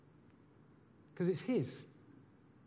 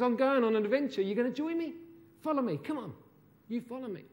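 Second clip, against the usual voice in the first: higher, eager invitation to follow; Jesus calling disciples; 7 semitones higher, faster than usual. Acted. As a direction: beckon them warmly, a touch of Jesus.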